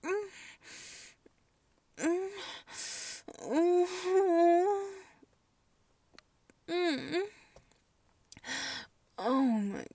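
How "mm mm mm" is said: high, hummed moans. Acted. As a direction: hum your pleasure upward. Moaning in pleasure.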